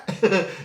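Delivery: quick laugh at the memory; short laugh. Real, not acted. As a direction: let a short laugh slip out. Laughing after the quote.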